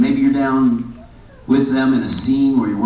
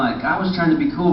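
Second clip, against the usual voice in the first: ordinary talk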